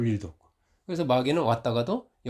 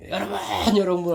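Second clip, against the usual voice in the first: louder, higher call to the residents; mock shouting to the crowd; 7 semitones higher, 5 dB louder than usual. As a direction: imitate a man rousing the neighbours. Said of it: "고래고래 소리 지르고". (주민들을 향해 외치는 흉내)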